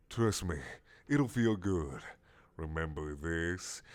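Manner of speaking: sly squid voice; low coaxing squid. Acted. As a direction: purr it low.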